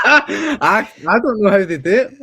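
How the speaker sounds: high, loud, through laughter; baffled and laughing, voice shooting up; laughing disbelief. Real, not acted. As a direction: crack up while protesting you don't get it. Baffled, laughing at how they do it.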